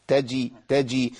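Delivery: تجي with the jeem held firm and slow; slow model of the letter jeem; slow clear jeem. Shown as a demonstration. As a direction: say تجي slowly, the jeem stopped and voiced. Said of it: "الجيم كده".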